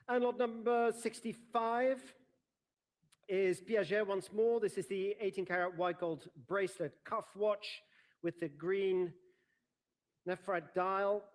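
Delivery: bright formal lot announcement; auctioneer lot announcement. Real, not acted.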